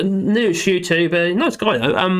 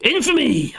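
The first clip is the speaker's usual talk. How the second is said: high theatrical cry; 10 semitones higher, slower than usual. Acted. Quoting a famous line.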